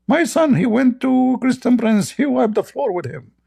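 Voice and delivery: high, proud mother's voice; mock proud mom. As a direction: gush like a doting mother. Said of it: "What your mom will say about you".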